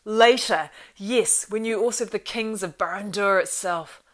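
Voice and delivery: high, loud scornful outburst; enraged scream. A narrator's reading. Saruman scornfully answering Gandalf's terms, screaming with rage. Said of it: "his voice rose to a scream".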